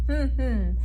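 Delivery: closed-lip hum, one beat per syllable; hummed syllables. Shown as a demonstration. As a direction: hum each syllable with lips shut. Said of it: "close their lips and hum it".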